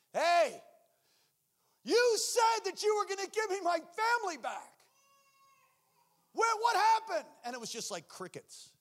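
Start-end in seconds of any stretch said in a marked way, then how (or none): 0.1-7.3 high, loud anguished demand; betrayed outcry. Retold or imitated. Man on driveway shouting at God, anguished and betrayed. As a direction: cry out to God feeling cheated.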